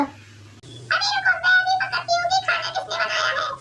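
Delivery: high, put-on old woman's voice; squeaky elderly saas, grumbling; old mother-in-law. (ऊँची आवाज़ में बूढ़ी सास)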